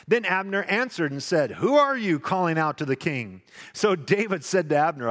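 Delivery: strong, carrying Bible reading; scripture reading. Acted. Preacher reading the passage aloud to the congregation, proclaiming. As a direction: read it out to the whole church.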